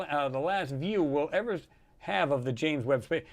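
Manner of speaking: ordinary talk, nothing marked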